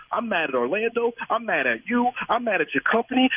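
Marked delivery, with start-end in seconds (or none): none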